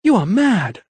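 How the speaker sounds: high, sharp outburst; cried out. A narrator's reading. Lady crying out at the vicar in anger. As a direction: fling it at him. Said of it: "she cried".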